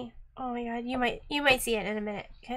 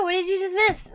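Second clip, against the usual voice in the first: higher, louder echoed question; question thrown back; 6 semitones higher, 5 dB louder than usual. Real, not acted. Host answering a viewer's question with the same one, thrown back.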